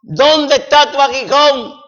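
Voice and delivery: ringing sermon cry; triumphant preaching. Acted. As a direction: declare victory over death from the pulpit. Preacher proclaims Christ's triumph.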